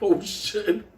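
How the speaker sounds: high, mock-frightened inmate voice; mock scared inmate. Inmates eyeing the scary guy, mock-frightened squeak. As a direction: squeak it out like a spooked prisoner.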